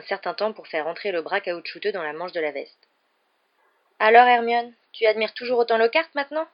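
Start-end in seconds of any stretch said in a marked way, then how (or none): 4.0-6.5 loud, higher needling question; raised voice, teasing from behind a partition; calling through curtain. A narrator's reading. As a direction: call over to a friend pointedly. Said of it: "dit Ron à travers le rideau".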